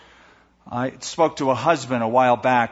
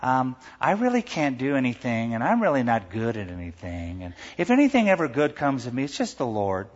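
mealy voice; 2 semitones lower, 3 dB quieter than usual. Acted. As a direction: parody false humility, droopy and self-effacing. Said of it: "you talk in a real mealy voice".

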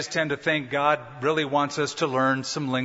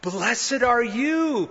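tender blessing; 8 semitones higher, much slower than usual. Retold or imitated. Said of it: "Jesus said".

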